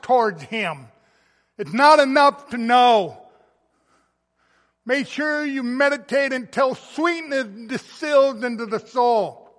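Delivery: loud, slow, ringing preacher's voice, building to a shout; preaching at full force. Real, not acted. Pastor urging his congregation to joy, full-force preaching. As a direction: preach it out to the back pews. Urging deep, sincere worship.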